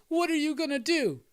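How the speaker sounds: high-pitched mock robber; hammed-up high victim voice; challenged crook. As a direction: shoot the question up high, playing the crook. Robber challenges superhero in retold scene.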